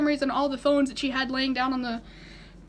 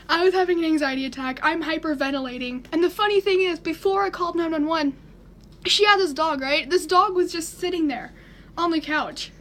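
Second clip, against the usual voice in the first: ordinary talk, nothing marked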